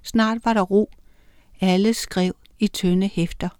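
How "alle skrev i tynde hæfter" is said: ordinary delivery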